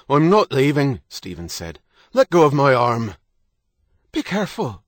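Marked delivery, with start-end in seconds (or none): 0.1-1.0 loud, raised-pitch defiance; defiant refusal. A narrator's reading. Husband resists being pushed out. 2.1-3.1 loud, high, forceful order; angry command. A narrator's reading. Husband struggles to free arm. 4.1-4.8 high-pitched anxious warning; alarmed cry. A narrator's reading. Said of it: "Kate cried".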